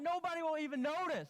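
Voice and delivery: high mock voice of a shrugging helper; mocking the leader. Preacher mimicking a leader who shrugs off the chairs, high mock voice. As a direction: pitch it up as the lazy helper. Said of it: "He's like".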